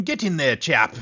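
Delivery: a lightly done mock British accent, cheery and a touch loud; terrible British accent. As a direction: play a bad British gent cheering on a wrestler in a British accent. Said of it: "That's my terrible British accent".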